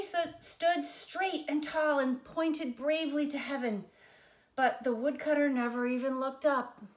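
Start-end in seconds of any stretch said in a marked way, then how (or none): none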